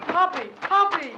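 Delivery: high, urgent calls across the yard; calling out. Acted. Frightened boy calls the hero's name from the dark. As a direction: call for help.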